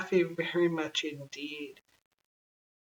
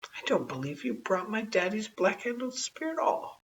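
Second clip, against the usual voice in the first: higher, lightly voiced little girl; a small girl doubting; 7 semitones higher than usual. A narrator's reading. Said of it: "said Taffy".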